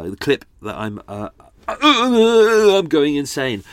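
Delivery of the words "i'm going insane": hammed-up frustration. Real, not acted. Loses track mid-sentence.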